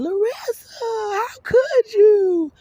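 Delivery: high, drawn-out anguished wail; screaming. Retold or imitated. Speaker on their knees crying out to Larissa, screaming. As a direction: wail it slowly, far up in pitch. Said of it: "I was screaming".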